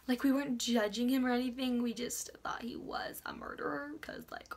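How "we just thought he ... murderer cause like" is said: whispered confession; sheepish whisper. Real, not acted. Girl admitting they thought the neighbor was a murderer, whispering. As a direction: drop to a guilty whisper. Embarrassed aside about neighbor.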